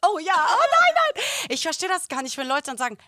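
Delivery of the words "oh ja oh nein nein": loud, high mocking cry; imitated over-the-top reaction; mock outcry. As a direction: play up a dramatic little outburst. Acts out someone asking for help.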